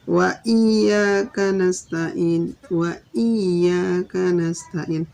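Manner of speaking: iyyaka with the yy pressed, slow and loud; doubled y. Shown as a demonstration. Teacher reciting the verse for students, loud slow iyyaka. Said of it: "tempatnya bertasdid".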